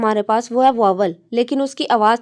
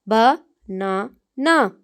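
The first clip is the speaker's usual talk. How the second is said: Banana drawn out very slowly, ba-na-na; stretched syllables; much slower than usual. Shown as a demonstration. Teacher drawing out a word to count its syllables. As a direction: stretch banana into three slow beats. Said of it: "इसके अंदर तीन syllables हैं".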